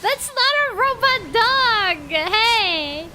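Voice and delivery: indignant shout. Real, not acted. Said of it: "From rat to robot dog".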